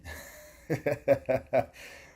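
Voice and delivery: high imitated nervous cackle; stress laugh. Friend covering for bad math, high mock stress laugh. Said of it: "Fat Boy stress laughs".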